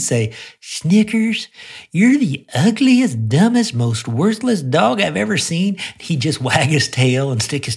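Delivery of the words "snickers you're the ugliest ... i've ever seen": high, cooing voice full of affection; sweet loving tone. Retold or imitated. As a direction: coo the insults like sweet nothings to the dog. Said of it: "as long as I said it with love".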